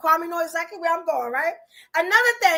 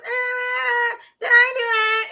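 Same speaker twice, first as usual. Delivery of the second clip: high, whiny nasal mimicry; nasally kid imitation; 6 semitones higher than usual. Host mocking a character's puberty voice, high and nasally. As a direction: imitate an aggravating nasal boy. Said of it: "got kinda nasally when they were kids".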